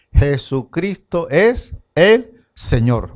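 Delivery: slow, each word laid down deliberately; slow declaration. Shown as a demonstration. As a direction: spell out "Jesucristo es el Señor" slowly and weightily. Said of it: "todos tienen que confesar".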